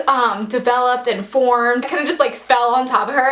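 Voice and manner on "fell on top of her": normal talking voice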